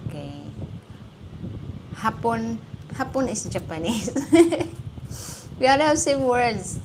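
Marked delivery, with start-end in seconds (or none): 4.1-5.5 a sudden high laugh, released on breath; high burst of laughter. Real, not acted. Laughing at a Japanese word mix-up.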